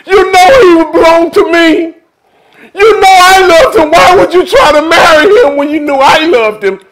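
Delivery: tearful, high, loud wailing ex-husband; crying jealous lover. Retold or imitated. Ex-husband crying at the wedding, sobbing and shouting. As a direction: wail it out like a heartbroken lover. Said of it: "he starts screaming and everything".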